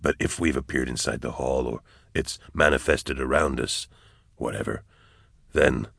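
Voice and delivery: low, hushed soldier's voice; soft, low, composed reasoning; steady soldier character. A narrator's reading. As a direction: keep it low and measured, working it out. Character's line in narrated story.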